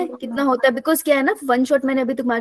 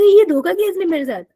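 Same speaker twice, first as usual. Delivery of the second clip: higher, louder mock indignation; mock betrayal; 6 semitones higher, 5 dB louder than usual. Teacher telling class about the student, in loud mock betrayal. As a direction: act hammed-up shock at being tricked. Mock outrage at early wake-up.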